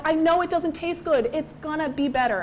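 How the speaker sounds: put-on frustrated mother urging her child; exasperated parent. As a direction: coax a stubborn kid. Parent coaxing child to take medicine.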